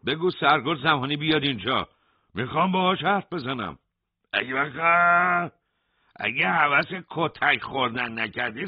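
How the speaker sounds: insistent demand. A narrator's reading. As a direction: push hard for the blankets back. Hossein demands the major. (اعتراض بلند و پافشارانه)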